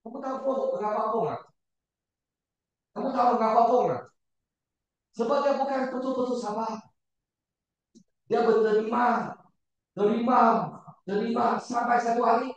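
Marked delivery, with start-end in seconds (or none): none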